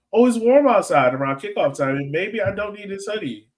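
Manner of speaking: ordinary delivery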